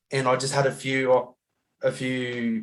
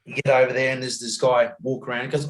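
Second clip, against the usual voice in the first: normal talking voice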